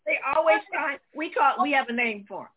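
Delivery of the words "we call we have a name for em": ordinary delivery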